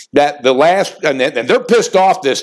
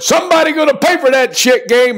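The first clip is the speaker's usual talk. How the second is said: pitched way up, chanted like a slogan; hyped catchphrase; 10 semitones higher than usual. Real, not acted. Host fires up viewers with his catchphrase, hyped. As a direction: bellow the show's battle cry with swagger. Signature catchphrase kicking off picks.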